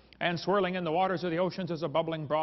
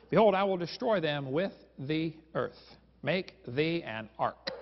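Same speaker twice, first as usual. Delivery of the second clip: slow, drawn-out scriptural decree; God's pronouncement; slower than usual. Retold or imitated. God commanding Noah to build an ark, slow and solemn. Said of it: "And God said to Noah".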